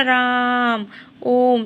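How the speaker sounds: राम and ओम with long drawn-out vowels; prolonged pluta vowels. Shown as a demonstration. Teacher showing students extra-long vowels, prolonged राम and ओम. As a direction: stretch the vowel in राम and ओम well beyond normal. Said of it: "ज़्यादा समय लगता है उसको, जैसे हम बोलते हैं".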